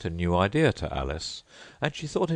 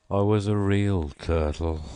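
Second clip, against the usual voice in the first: deep sigh; 3 semitones higher, 4 dB louder than usual. A narrator's reading. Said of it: "with a deep sigh".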